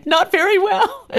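high, laughing admission; laughing reply. Retold or imitated. To God, confessing she falls short, laughing high. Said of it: "of course you say".

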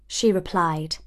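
plain reading voice

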